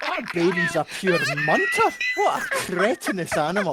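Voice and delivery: loud, blunt, mock insult. Scottish local loudly insulting someone's ugly baby in jest. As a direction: bellow the insult for laughs.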